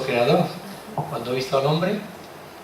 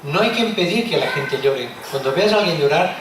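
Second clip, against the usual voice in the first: ordinary talk, nothing marked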